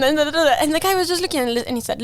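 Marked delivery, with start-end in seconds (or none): none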